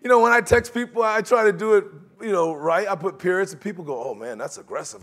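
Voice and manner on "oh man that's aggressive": low, soft mimicking voice; mock disapproval. Preacher mimicking people reading his periods, low disapproval. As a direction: say it low, like a wary critic.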